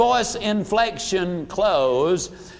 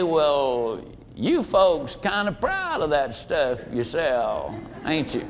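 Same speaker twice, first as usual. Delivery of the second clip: slow, lightly voiced down-home Southern old boy; a hint of a folksy country philosopher, unhurried; down-home drawl; 5 semitones lower, 4 dB quieter than usual. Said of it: "an old boy down home kind of a philosopher".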